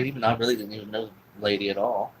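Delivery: ordinary talk, nothing marked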